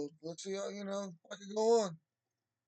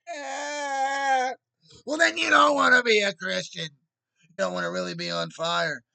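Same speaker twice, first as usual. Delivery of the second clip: much louder, yelling in frustration; furious loud outburst; angry shout; 5 semitones higher, 12 dB louder than usual. Real, not acted. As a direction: blow up and shout at them.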